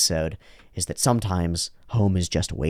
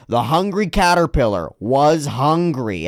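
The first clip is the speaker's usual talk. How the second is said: slow, higher, exaggerated picture-book read; big-text storybook reading; 5 semitones higher, 3 dB louder, slower than usual. Big text read out for a child, exaggerated storybook voice. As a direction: stretch each word out for a small child. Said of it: "it's like big text that says".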